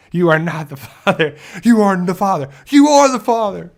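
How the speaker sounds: building from plain to a loud, high announcement; talk show reveal. Acted. Host reading out paternity results, rising to a loud reveal. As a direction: build each line up like a TV host's big reveal.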